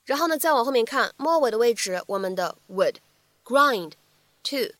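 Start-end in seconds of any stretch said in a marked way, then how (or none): 2.7-4.7 "would", "grind", "to", each after a pause; unlinked "would grind to"; words kept apart. Shown as a demonstration. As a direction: say "would grind to" one word at a time. Separate words before linked demo.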